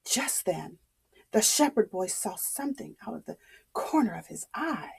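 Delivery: hushed, fast suspense whisper; suspenseful whisper. A narrator's reading. Storyteller building suspense for the child, whispered.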